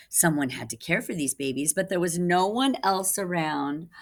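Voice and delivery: usual speaking voice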